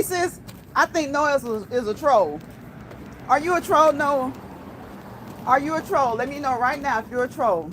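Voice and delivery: ordinary talk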